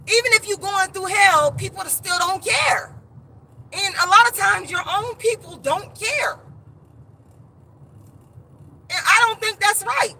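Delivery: high-pitched, hurt protest; worked up and aggrieved; impassioned grievance. Real, not acted. Impassioned complaint about nobody caring.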